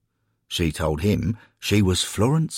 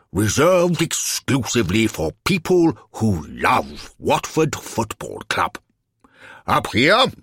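pontificating alderman; 4 semitones higher, 4 dB louder than usual. Acted. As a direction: hold forth grandly like a self-important official. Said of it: "sensed an opportunity to pontificate".

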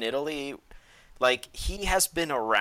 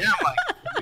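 high laughing voice; breaking into high laughter; 3 dB louder than usual. Real, not acted. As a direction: laugh through the lead-in. Laughing through retelling.

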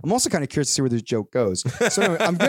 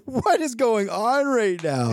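high, slow, drawn-out disbelief; bewildered dad; 10 semitones higher, much slower than usual. Retold or imitated. Dad reacting to his daughter's mad laughter, high bewildered cry. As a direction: stretch it out in baffled alarm. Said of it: "And I'm like".

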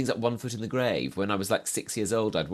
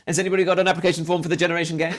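high, loud, rushed comic appeal; hammed-up fast shout for help; mock urgent plea; 9 semitones higher, 7 dB louder, much faster than usual. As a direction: call out hurriedly as if being dragged away, in jest. Joke plea while being abducted.

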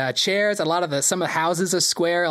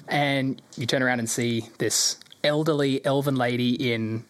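usual speaking voice

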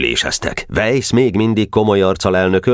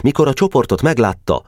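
plain reading voice

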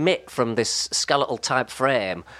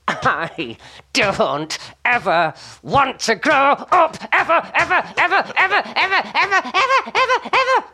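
rising squeaky chant of ever, climbing higher and laughing; childlike wail going higher and higher; childish vow; 10 semitones higher, 4 dB louder, slower than usual. Retold or imitated. Kid bouncing into a bean bag, pleading to never grow up.

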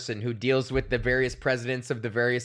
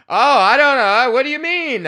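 much higher, louder feigned confusion; mock playing dumb; 10 semitones higher, 9 dB louder than usual. Comic telling cop he has no idea, playing dumb. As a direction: act clueless at the cop. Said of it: "And I'm like".

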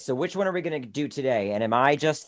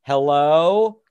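wary calling out; 3 semitones higher, 8 dB louder than usual. Acted. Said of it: "I'm scared, but I'm kinda like".

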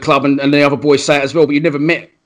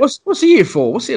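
lightly voiced puzzled child, pitched higher; a curious kid wanting to know, higher voice; imitating the daughter; 8 semitones higher than usual. Host recalls the daughter asking about the filming, higher childlike. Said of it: "your daughter was like".

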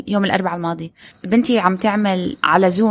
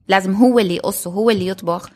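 usual speaking voice

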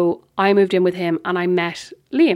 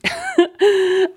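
a high burst of laughter; short high giggle; laughing; 12 semitones higher than usual. Real, not acted. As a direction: laugh at the memory. Laughs at drunk quote.

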